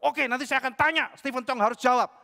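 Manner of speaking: plus aigu et plus fort, provocateur; défi arrogant. Retold or imitated. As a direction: lancer le défi avec suffisance. Pria sombong menantang.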